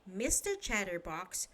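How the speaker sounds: usual speaking voice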